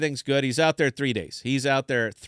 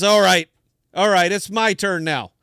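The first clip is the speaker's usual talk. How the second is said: loud, high announcement; worked-up city guy, raised voice; acted fired-up challenge; 6 semitones higher, 8 dB louder than usual. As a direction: declare it forcefully, ready for payback. Recovered city guy demands his turn.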